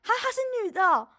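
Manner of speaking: put-on high, loud startled she's a girl; surprised tone. Shown as a demonstration. Showing her friend his surprised pitch, high and startled. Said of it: "这个差不多这样的音调".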